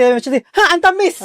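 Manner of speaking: high squeal on "ang tamis"; patient recoiling from a too-sweet drink; startled high cry of surprise; shocked at sweetness. Retold or imitated. Patient tastes sweet juice.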